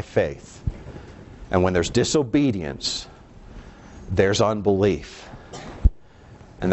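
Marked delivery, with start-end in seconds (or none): none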